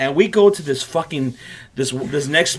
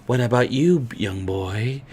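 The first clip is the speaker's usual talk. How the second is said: soft, lower creepy voice; low and slow like an old creep; creepy owner addressing the young boy; creepy old man; 5 semitones lower, 4 dB quieter, slower than usual. Retold or imitated. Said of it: "And he goes".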